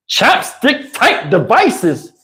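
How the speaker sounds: slow, incredulous echo of her words; slow mocking repeat. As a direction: drag out each word in disbelief. Mocking her odd question.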